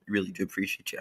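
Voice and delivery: breathy whisper of thanks. Real, not acted. Host whispering thanks to a viewer, quiet. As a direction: whisper it like a secret.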